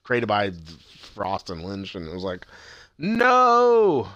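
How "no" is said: long, high, loud wail; hammed-up drawn-out dismay; mock anguished cry. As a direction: howl in protest at the cliffhanger. Reliving reaction to the cliffhanger ending.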